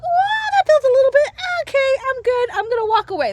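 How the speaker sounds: high, startled, backing off; mock startled retreat. Imitating people backing away, startled and high. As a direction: pitch way up like someone spooked. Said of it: "That's what most people do".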